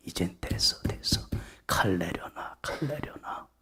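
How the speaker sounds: soft, whispered command; gentle whisper. Acted. God telling Abraham to put down the knife, whisper. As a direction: hush him to stop. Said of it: "하나님이 아브라함한테 뭐라고 그래요".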